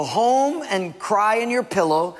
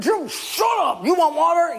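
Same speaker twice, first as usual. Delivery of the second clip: angry Moses; 13 semitones higher than usual. Acted. Moses to the thirsty people, angry shout. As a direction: cut them off with a yell.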